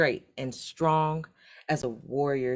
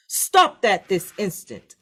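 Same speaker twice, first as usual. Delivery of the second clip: slow, louder commanding shout; 5 semitones higher, 7 dB louder than usual. A narrator's reading. Village chief shouting at the fighting brothers, slow commanding shout. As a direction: stop the fight with one command. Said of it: "the chief shouted".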